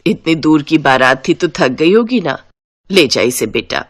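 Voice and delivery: low-pitched, motherly instructions; caring mother-in-law. Acted. (फ़िक्र करती सास की नीची आवाज़)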